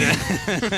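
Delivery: bursting into laughter; laughing. Real, not acted. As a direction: laugh mid-impression. Laughing through the bit.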